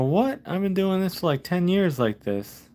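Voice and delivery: high, surprised What, settling back toward normal; startled high protest that slows at the end; retold disbelief. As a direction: replay your own shocked reaction. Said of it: "I go".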